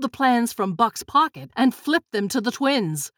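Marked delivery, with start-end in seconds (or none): none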